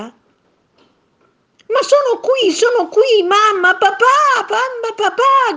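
very high, loud, drawn-out cries; desperate child shout. A narrator's reading. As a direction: cry out very high and slow to parents who can't hear. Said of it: "gridava Tonino". (grida disperate, acute e lente)

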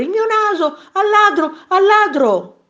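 high, loud cry of stop thief; urgent shout. A narrator's reading. Robbed man calls out from the balcony, urgent shout. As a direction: raise the alarm in a high yell. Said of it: "gridò il signore".

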